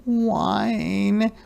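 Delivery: high, drawn-out sad whine; high-pitched lonely puppy whine; whining wolf cub. Acted. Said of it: "wolf cub is feeling very sad".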